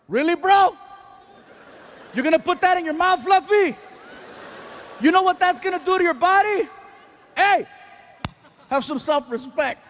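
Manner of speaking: hammed-up yell at a friend over muffins; Martin yelling. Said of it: "He starts yelling".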